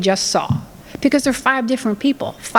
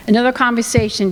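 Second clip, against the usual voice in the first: ordinary talk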